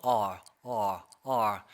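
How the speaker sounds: R with open throat and tongue pulled back; correct R sound. Shown as a demonstration. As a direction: say R slow and low, tongue drawn back. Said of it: "목구멍을 열고 혀를 당겨주는 겁니다". (R을 목구멍 열고 혀 당겨 낮게)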